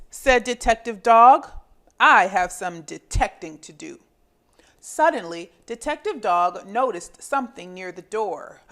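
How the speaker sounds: put-on lively read-aloud for young children; storybook reading. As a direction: read it like a picture book to little kids. Reading picture book to children.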